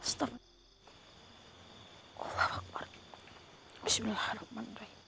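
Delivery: hushed, voiceless prayer; whispered prayer. Real, not acted. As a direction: murmur the prayer so the spirit can't hear. Whispered prayers during the ritual.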